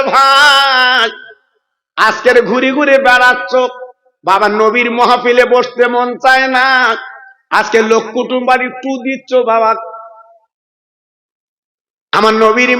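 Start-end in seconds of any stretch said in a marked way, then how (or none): none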